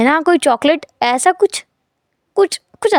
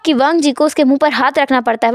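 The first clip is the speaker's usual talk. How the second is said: normal talking voice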